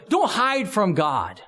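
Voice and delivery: usual speaking voice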